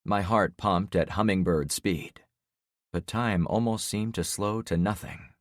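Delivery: plain reading voice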